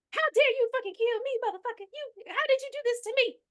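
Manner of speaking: high furious possessed woman voice; high angry possessed voice; possessed Latasha. Retold or imitated. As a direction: shriek it like a spirit taking over. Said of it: "he jumps up as Latasha".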